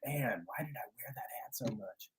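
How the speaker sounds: imitating Ben in a soft whisper; breathy whisper, like a rueful teenager; whispered imitation. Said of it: "he's just gonna be like".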